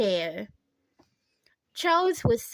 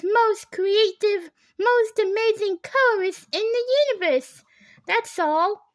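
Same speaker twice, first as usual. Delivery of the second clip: high, louder starstruck gush; 8 semitones higher, 4 dB louder than usual. A narrator's reading. Stylist raving about famous colorist, high gushing. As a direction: worship him out loud.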